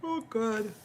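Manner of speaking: talking through a yawn. Real, not acted. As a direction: stretch it out mid-yawn. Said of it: "That's why I'm yawning".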